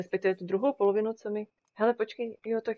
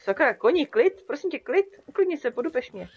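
louder, higher, hurried pleading; urgent calming; 7 semitones higher, 7 dB louder than usual. Real, not acted. Rider urging her panicking horse to calm down, urgent. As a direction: settle a spooked horse fast.

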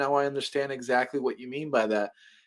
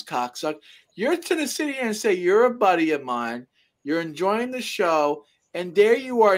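higher-pitched, heated tirade, rebuking a fake friend; retold angry rant; 6 semitones higher than usual. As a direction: tell off the traitor, voice pitched up and worked up. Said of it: "I say".

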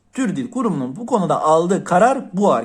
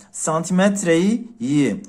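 slow example; slower than usual. Shown as a demonstration. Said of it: "bakın gör arkadaşlar".

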